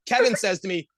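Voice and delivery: ordinary delivery, nothing marked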